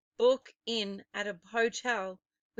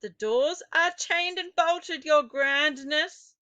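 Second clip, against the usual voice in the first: higher, louder eager witch reply; a witch in the audience answers, higher and louder; answering witch; 6 semitones higher, 6 dB louder than usual. Acted. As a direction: answer the leader from the crowd. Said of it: "answered a voice in the audience".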